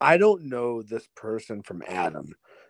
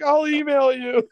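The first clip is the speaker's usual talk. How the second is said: loud, high weepy squeal; mock tearful; 13 semitones higher, 5 dB louder than usual. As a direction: imitate a tearful promise to a new friend. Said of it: "And I'm crying".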